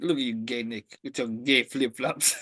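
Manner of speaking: low sneering imitation; mock disgust. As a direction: imitate someone disgusted, dragging out the final insult. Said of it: "killing the disgust that he had in his voice".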